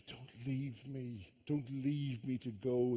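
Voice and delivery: slow, drawn-out plea; mock pleading. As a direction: plead slowly as the clingy wife. Wife pleads with departing husband.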